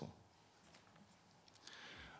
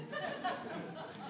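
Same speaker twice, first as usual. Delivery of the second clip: high breathy giggle; helpless little wheeze of laughter; 7 dB quieter than usual. Real, not acted. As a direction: crack up at your own joke. Cracks up at own line.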